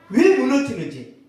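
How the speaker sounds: normal talking voice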